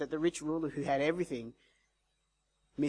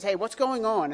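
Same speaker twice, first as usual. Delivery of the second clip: higher, louder curious call; 4 semitones higher, 5 dB louder than usual. Retold or imitated. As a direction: retell the beggar asking the passing crowd. Beggar calls to the crowd.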